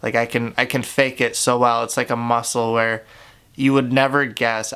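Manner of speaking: ordinary delivery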